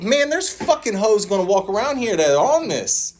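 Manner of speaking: high-pitched, agitated objection; acting out a worked-up complaint; retold protest. Acted protest to the sponsor.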